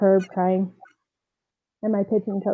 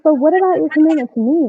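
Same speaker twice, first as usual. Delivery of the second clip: higher, louder protest; indignant retort; 7 semitones higher, 4 dB louder than usual. Retold or imitated. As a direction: push back, upset and raised. Arguing back at director.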